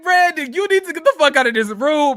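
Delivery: high, loud, fast warning; urgent yell. Retold or imitated. Viewer yelling at Brandon on screen to leave. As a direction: yell at him to get out, now. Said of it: "I was like".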